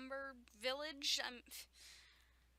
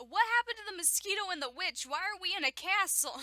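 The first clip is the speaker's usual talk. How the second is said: loud, high, incredulous questions; baffled outburst; 8 semitones higher, 10 dB louder than usual. Real, not acted. Reader protesting the story's plot holes, loud and incredulous. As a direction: fire off exasperated questions at full voice.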